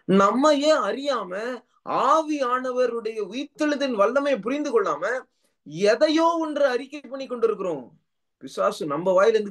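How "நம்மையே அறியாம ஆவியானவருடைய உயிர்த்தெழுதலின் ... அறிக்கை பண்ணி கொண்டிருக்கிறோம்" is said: high-pitched, driving sermon delivery; impassioned preaching. Real, not acted. Preacher to congregation on resurrection, impassioned preaching.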